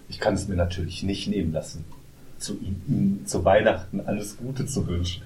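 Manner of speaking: low, soft, sinister politician voice; quiet menacing murmur, imitating Spacey; Frank Underwood. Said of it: "so eine totale Frank Underwood-Sache". (leise, unheimliche Underwood-Stimme)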